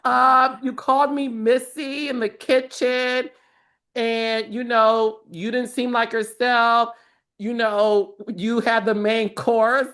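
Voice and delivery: high-pitched, drawn-out mock questioning; imitating Amanda. Castmate quoted asking Sutton about yesterday at dinner, high mock voice. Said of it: "Amanda, for the second night at dinner, goes".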